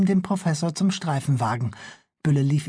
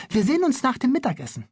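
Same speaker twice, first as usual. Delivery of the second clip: put-on young girl Liese; 9 semitones higher, faster than usual. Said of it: "sagte Liese".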